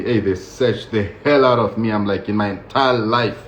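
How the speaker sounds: ordinary talk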